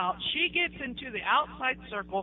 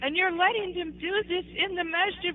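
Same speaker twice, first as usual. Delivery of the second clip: retold yelling; 7 semitones higher than usual. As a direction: replay scolding the sheikh, high and stretched. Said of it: "started yelling at him".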